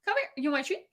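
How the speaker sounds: high coaxing call to a pet; talking to a dog. Real, not acted. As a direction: coax the dog up, sing-song high. Calling her dog over.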